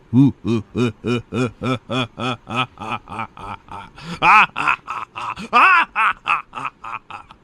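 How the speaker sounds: long, booming evil cackle; evil laugh. Pretend. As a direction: cackle like a movie villain. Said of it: "Best villain laugh".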